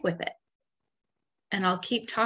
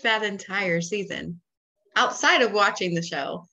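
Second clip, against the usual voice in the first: ordinary talk, nothing marked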